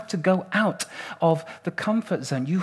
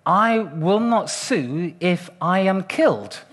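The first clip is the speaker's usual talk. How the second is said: slow, measured reading out of a waiver; deliberate recital; slower than usual. Acted. Guy with a clipboard has you agree to the form, deliberate recital. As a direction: recite it word by word, slowly.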